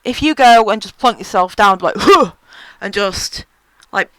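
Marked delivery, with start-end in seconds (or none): 2.0-2.3 loud, high puff of "huh"; mock huff. Showing patients how not to sit down, mock huff. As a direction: huff as if plonking yourself down. Mimics plonking down rudely.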